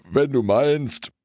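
lazy dog. Acted. Dog reluctantly gets up.

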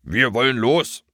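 unconvincing yappy dog call; yapping dog. Acted. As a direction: bark the words half-heartedly. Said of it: "kläffte der Hund".

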